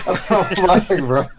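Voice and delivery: laughing "bro" at the screen. Real, not acted. Friend laughing at a silly movie moment. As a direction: laugh it out, amused and incredulous.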